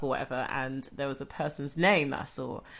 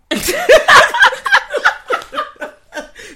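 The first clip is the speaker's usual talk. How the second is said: high, loud burst of laughter; loud laughing burst; 14 dB louder than usual. Real, not acted. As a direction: crack up at your own act. Bursts out laughing after joking act.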